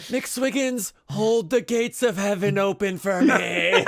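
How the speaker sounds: hammed-up dying plea, laughing; slow laughing deathbed speech; dying farewell. Dying soldier's melodramatic farewell.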